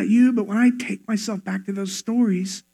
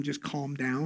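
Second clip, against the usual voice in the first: low, softer aside; telling someone to calm down; 8 semitones lower, 15 dB quieter than usual. Shown as a demonstration. Telling an upset loved one, low aside. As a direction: say it low and offhand. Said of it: "to tell them".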